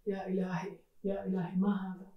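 put-on a scared boy whispering to himself; frightened. Aladdin, alone at the dark door, frightened and hushed.

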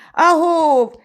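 loud, high holler back; далекий відгук. A narrator's reading. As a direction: answer with a far-carrying call. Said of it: "чується десь далеко праворуч". (гучний високий відгук здалеку)

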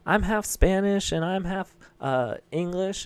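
high mock kid voice. Classmate sharing heritage in class, high kid voice. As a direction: pipe it up like a schoolkid. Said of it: "this one kid was like".

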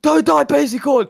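high, loud desperate pleas; frantic yelling. Real, not acted. Player urging teammate not to die, frantic high shout. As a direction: scream at him to survive. Cheering teammate in tense moment.

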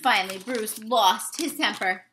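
ordinary delivery, nothing marked

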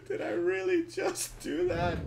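high, laughing, incredulous; laughing disbelief. Real, not acted. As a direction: crack up at own blunder. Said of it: "repeated louder".

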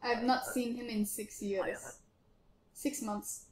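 ordinary talk, nothing marked

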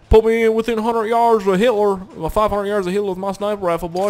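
a hint of the movie sniper; Jackson impression. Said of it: "like Jackson from Saving Private Ryan".

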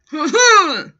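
loud, high scoffing laugh; mocking laugh. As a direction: burst out in a hammed-up sneer laugh. Orderly reacts to demand.

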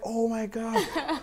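high, slow mimicked gasp of amazement; stunned disbelief. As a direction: stretch it out high, mock-stunned. Retold stunned reaction to news.

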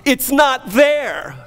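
high, loud, slow triumphant cry; exultant, ringing preacher's shout; joyful shout. Retold or imitated. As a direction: shout the release to the whole church. Freed man's shout of release.

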